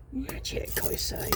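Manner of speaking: whispered, as if marvelling; hushed, savouring the word; hushed emphasis. Real, not acted. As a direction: drop to a soft whisper. Awed repeat of magic.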